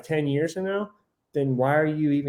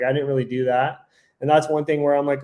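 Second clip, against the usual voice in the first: ordinary delivery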